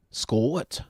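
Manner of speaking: "Scot" split into two syllables, Scottish-style; Scottish accent. Shown as a demonstration. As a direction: stretch "Scot" with a Scottish glide in the vowel. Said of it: "two syllables of that".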